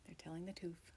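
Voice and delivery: hushed agreeing whisper. Real, not acted. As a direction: whisper it back.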